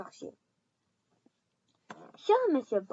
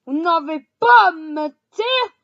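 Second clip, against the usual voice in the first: loud, high furious roar; furious shout; 6 semitones higher, 15 dB louder than usual. A narrator's reading. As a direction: roar it at the Dursleys. Hagrid furious speech.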